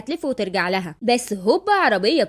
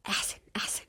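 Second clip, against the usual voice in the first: very soft, voiceless whispered aside; gloating whisper; 14 dB quieter than usual. Acted. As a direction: whisper your approval under your breath. Narrator's aside cheering the slap. (همس شماتة)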